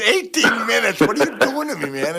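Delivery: can't believe the match length; incredulous. Retold or imitated. Ric to Pat about the long match, incredulous.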